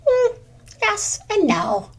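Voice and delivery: a high, slow, pondering hum; thoughtful squirrel. Acted. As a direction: muse it over gently. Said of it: "responded Generous Squirrel".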